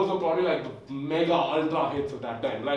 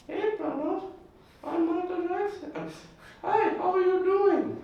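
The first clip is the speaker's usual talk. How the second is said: [name] impression. Comic doing [name] to the audience, very high falsetto. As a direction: float it up high and gentle like Jackson. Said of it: "I'm gonna try imitating him".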